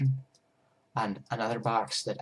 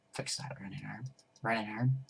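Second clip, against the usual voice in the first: soft unvoiced whisper; hushed repeat; whispered command; 12 dB quieter than usual. Real, not acted. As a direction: breathe the words like a private cue. Whispering commands while fixing.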